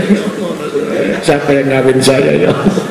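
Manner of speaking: high, laughing mock lament; laughing mimicry of widow. Widow crying for a husband, mimicked with laughter.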